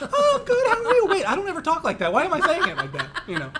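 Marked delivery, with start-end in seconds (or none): 0.0-1.0 high, loud, phony-polite chirp through laughter; Dave Chappelle. Retold or imitated. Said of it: "think of Dave Chappelle doing that". 1.1-3.2 high, loud comic bafflement, laughing; Dave Chappelle. Retold or imitated. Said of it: "think of Dave Chappelle doing that".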